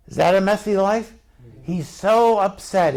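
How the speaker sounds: normal talking voice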